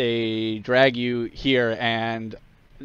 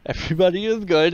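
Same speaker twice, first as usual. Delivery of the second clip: fast, higher, gleeful repeat; hammed-up chirpy; 7 semitones higher, 3 dB louder, much faster than usual. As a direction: toss it off with glee. Acted repeat, laughing.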